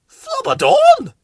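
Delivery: very high, slow puzzled squeak. A narrator's reading. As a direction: stretch it out, baffled and high. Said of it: "puzzled Bill".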